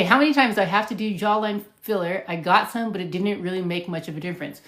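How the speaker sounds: ordinary talk, nothing marked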